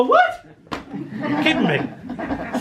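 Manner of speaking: what? squealed way up high, then a slow laughing drawl; a child stunned by the news; shocked disbelief. Retold or imitated. Boy hearing Mrs Sweeney isn't his mammy, laughing shock.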